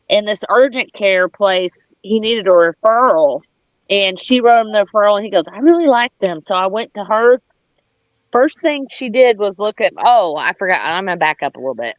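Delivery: ordinary delivery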